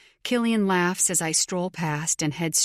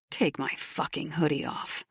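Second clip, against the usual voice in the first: gruff male voice; 2 semitones lower, 6 dB quieter than usual. Acted. Biker orders her to strip hoodie.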